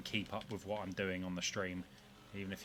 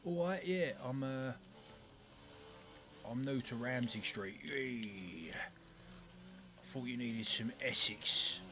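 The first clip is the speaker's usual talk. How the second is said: put-on Essex accent; 3 semitones higher, slower than usual.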